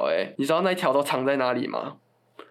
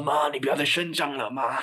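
hoarse sick voice. Retold or imitated. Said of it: "很沙哑的声音说".

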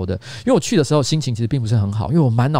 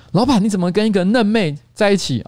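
higher, playful imitation of teasing staff; teasing staffers; 7 semitones higher than usual. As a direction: tease the boss lightly. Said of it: "就是他们这么说".

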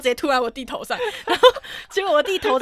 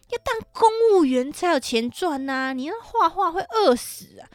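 higher, slow, looking down on the niece; disdainful elder; 6 semitones higher, slower than usual. Retold or imitated. Relative scorns the niece's art dream, slow and disdainful. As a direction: drag it out with open contempt like a nosy relative. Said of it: "露出一个超嫌弃的脸".

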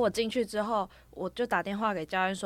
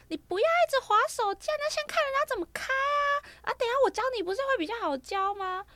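high, pinched mimicry of the coach; squeaky caricature of the driving coach; mock coach voice. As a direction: ham up the coach's whiny insistence. Mimicking coach in retold story.

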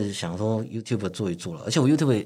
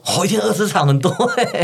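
surprised; 9 semitones higher, 3 dB louder than usual. Real, not acted. Hearing twenty games a day.

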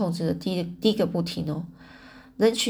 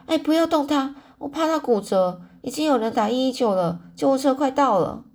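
urgent warning; 6 semitones higher, 4 dB louder than usual. A narrator's reading. Urgent warning at accident scene.

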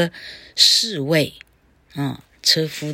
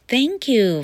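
high, grateful Cinderella; storybook heroine's delighted thanks; 7 semitones higher than usual. Acted. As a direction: lift the pitch with happy gratitude. Cinderella thanks her fairy godmother.

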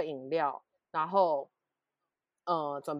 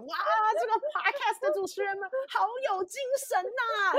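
far higher, gushing mock admiration; hyped-up listener; 5 dB louder than usual. Imagined listener praising the podcast hosts, mock-excited. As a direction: play an awed listener raving about peppy hosts. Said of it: "然后你就觉得".

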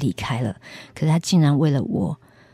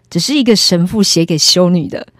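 smug; 3 semitones higher, 7 dB louder than usual. Retold or imitated. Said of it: "他就很得意跟我说".

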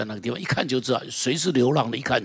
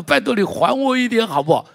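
high, loud begging plea; hammed-up pleading; 9 semitones higher, 6 dB louder than usual. Preacher imitating a man begging God, high and loud. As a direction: beg God to pay it back, high and loud.

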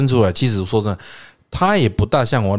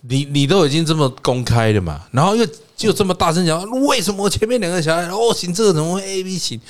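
retold loud father; 7 semitones higher than usual.

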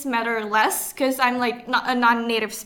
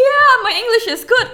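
high, fast, loud insistence; defensive protest; 10 semitones higher, 7 dB louder, faster than usual. Retold or imitated. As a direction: protest a bit too eagerly. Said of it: "And, and I'm like".

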